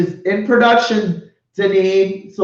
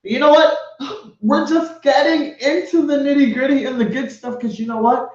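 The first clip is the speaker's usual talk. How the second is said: ordinary talk, nothing marked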